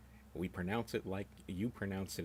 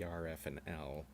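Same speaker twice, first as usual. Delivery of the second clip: ordinary talk, nothing marked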